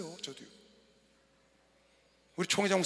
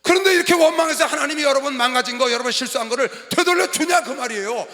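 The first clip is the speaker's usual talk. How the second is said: raised, driving pulpit voice; forceful preaching; 6 semitones higher, 12 dB louder than usual. Acted. Pastor challenging congregation about blaming God, forceful preaching.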